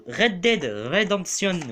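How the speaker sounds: slow English title in a French accent. Real, not acted. Vlogger reading a game title to viewers, French accent. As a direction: say the English name slowly with French sounds. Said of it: "Désolé pour mon accent anglais".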